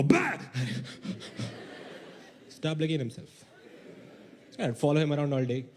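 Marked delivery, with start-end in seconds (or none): 0.0-0.4 short loud "Bah!" to startle; sharp bah. Retold or imitated. Comic showing how he startled the licking dog, short loud bah. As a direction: bark it to scare the dog off. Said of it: "I would go".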